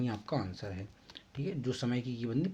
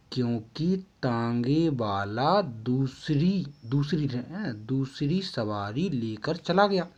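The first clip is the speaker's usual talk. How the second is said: slow, word by word for note-taking; spelled out slowly for copying; slow dictation; 3 dB louder, slower than usual. Shown as a demonstration. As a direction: dictate it slowly so students can write. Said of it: "आपको ये लिखना है".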